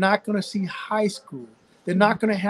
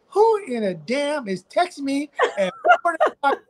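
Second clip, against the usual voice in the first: higher, laughing mock outrage; half-laughing mock indignation at a four o'clock text; laughing retold outburst; 7 semitones higher than usual. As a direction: laugh through the line in fond disbelief. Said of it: "I said".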